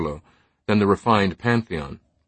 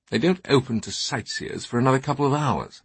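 put-on slightly raised, highbrow British older scholar; highbrow British accent; 4 semitones higher, 3 dB quieter than usual. Teabing reading the board to his companions, highbrow British accent. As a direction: speak in a cultured upper-crust British accent. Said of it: "his accent a highbrow Saxonesque British".

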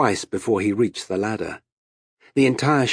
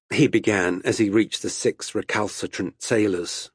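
plain reading voice

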